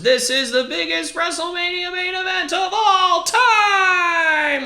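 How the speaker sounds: booming, drawn-out ring announcer call; hype announcer. Retold or imitated. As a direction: sell it like the biggest event ever. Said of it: "literally the announcement was".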